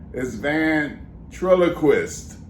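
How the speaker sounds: ventriloquist drawn out slowly, every syllable clear; slow careful ventriloquist. Shown as a demonstration. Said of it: "Say it".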